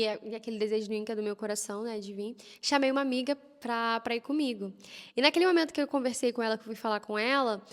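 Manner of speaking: ordinary delivery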